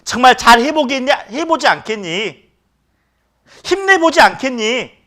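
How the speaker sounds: high, coaxing encouragement; loving encouragement. Retold or imitated. Jesus encouraging Peter, high coaxing voice. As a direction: urge him on tenderly. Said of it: "정말 사랑스러운 눈빛으로".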